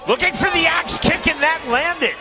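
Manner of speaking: high-pitched burst of live commentary; excited fight call. Real, not acted. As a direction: call the landed strike as it happens, voice jumping up. Live call of a landed kick.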